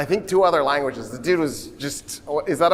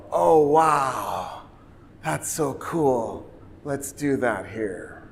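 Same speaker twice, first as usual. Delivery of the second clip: slow, hushed, softer mock awe; dreamy awe; 3 semitones lower, 4 dB quieter, much slower than usual. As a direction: drift off in wide-eyed wonder, slowly. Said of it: "And the Italians go".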